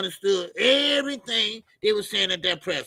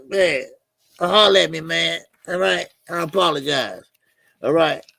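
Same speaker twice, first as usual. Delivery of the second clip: ordinary delivery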